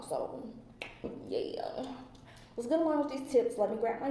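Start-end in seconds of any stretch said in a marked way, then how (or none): 0.0-1.8 whisper. Real, not acted. Trailing off into a whisper.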